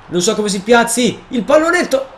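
frantic cry as the chance slips away; panicked gamer shout. Real, not acted. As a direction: blurt it out mid-play, voice shooting up. Panics during scoring chance.

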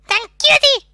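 loud high cutesy squeal. Pretend. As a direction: squeal the word cutie. Cutesy voice about fanart.

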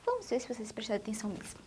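playful quick whisper. Acted. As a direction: lean in and whisper it to the class. Teacher teasing pupils about paying attention.

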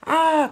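high, loud scream; frightened cry. A narrator's reading. Engine chased by Diesel ten, high frightened scream. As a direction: shriek as the villain charges. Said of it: "cried Thomas".

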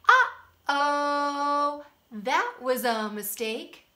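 loud mock alarm for children; hammed-up oops to the kids; playful uh-oh.

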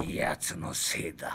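whispered, resentful aside; hushed grudge, half to himself; bitter whisper. Acted. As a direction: breathe the blame under your breath. Bitter aside about rival.

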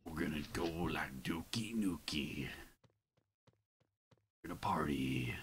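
imitating the game voice in a whisper; mock whisper. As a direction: hiss it like a secret hype line. Copying the game character's line.

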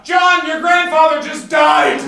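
high, loud, slow shout; mom shouting through door. Retold or imitated. Mom shouting bad news through the bedroom door. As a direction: call it through a closed door. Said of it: "said through the door".

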